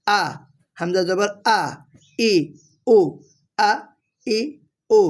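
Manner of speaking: আ, ই, উ as separate short glottal pops; sharp hamza. Shown as a demonstration. Teacher demonstrating hamza, crisp popping আ, ই, উ. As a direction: pop each আ, ই, উ from the start of the throat. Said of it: "টস টস করে আওয়াজ বের হবে".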